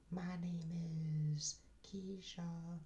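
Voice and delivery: high, soft and slow sassy voice; sassy girl voice. Acted. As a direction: purr the name out, high and slow. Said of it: "those three voices".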